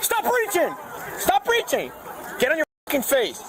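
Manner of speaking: high, forceful repeated commands; shouting orders over battle. Real, not acted. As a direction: bark each order hard. Officer yelling at suspect.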